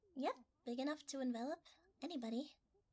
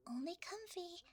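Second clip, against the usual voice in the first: ordinary delivery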